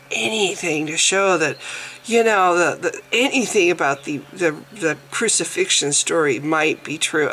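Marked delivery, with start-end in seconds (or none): none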